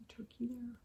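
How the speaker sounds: breathy whisper. A narrator's reading. Narrator whispers an aside about turkey, whisper.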